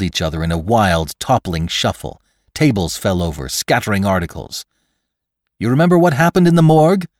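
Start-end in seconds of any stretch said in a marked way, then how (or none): 5.6-7.1 high, loud, rapid question; urgent reasoning. Acted. Victim reminds his attacker mid-struggle, urgent. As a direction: fire the question off urgently, pitched up. McClure argues during the struggle.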